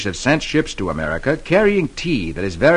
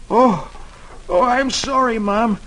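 put-on sleepy boy voice; 9 semitones higher than usual. Boy wakes from dream.

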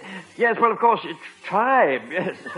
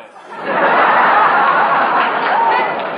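hearty laugh; 5 dB louder than usual. Acted. Laughs at own Churchill pun.

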